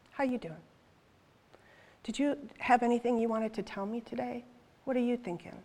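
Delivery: quiet, friendly check-in; intimate, casual prayer. Retold or imitated. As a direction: ask gently, leaving room for an answer. Contrasts an intimate chat with God.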